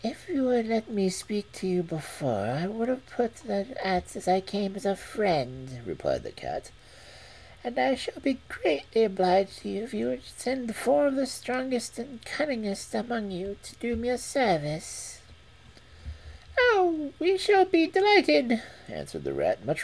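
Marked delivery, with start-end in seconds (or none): 0.0-15.2 cat voice. Acted. Cat replies. 16.6-18.6 very high squeaky rat voice; eager, relieved little rodent. Acted. Rat replies.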